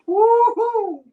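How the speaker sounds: high, loud woo-hoo; excited cheer. Real, not acted. Cheering at close guesses.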